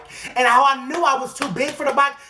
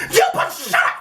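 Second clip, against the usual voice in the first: very high screech; enraged comeback. Acted. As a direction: cut them off with a hammed-up shriek. Snaps back at the calming voice.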